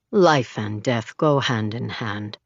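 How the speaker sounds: low, slow and easy; as if he knows the world; easy, knowing man. A narrator's reading. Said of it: "he says easily".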